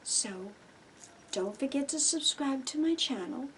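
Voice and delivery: usual speaking voice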